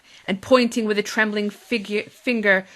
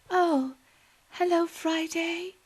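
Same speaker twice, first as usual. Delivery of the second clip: weak; 9 semitones higher, slower than usual. Acted. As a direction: speak weakly. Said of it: "said Missus Lovely weakly".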